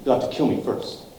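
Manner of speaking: defiant whisper. Acted. Defiant prisoner facing pirates.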